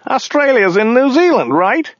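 very high-pitched driver voice; driver character. A narrator's reading. Driver confusing countries with his passenger, very high character voice.

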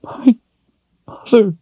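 high sobbing cry; high, sobbing woman's voice. Acted. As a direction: break into a sob on mother. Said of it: "she sobbed".

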